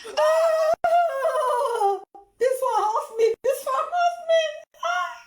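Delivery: high wailing laughter, near tears; cry-laugh in disbelief; helpless shrieking laugh; laughing till crying. Real, not acted. Reaction video, crying laughter.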